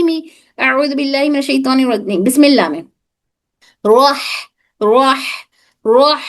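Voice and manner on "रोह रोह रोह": रोह with a strong breathy ह from the middle of the throat. Shown as a demonstration. Teacher drilling her students on the middle-throat ह in रोह. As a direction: push air hard from mid-throat on रोह, with a pause after each. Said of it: "ये हा हलक के बिल्कुल दरमियानी हिस्से से".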